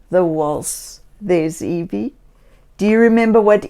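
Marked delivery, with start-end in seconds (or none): none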